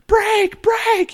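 high, loud imitation of a panicked passenger; shrieked mock alarm, very high; freaking-out backseat yell. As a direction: squeal it out, mimicking someone in a panic. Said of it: "and freaking out".

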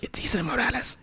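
put-on soft whisper with Mexican-accented name. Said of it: "whispering with accents".

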